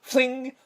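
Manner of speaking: high-pitched "fling" sound effect; mimicked metallic swish; imitated fling noise. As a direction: voice the sudden noise, high and ringing. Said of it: "And then all you hear is".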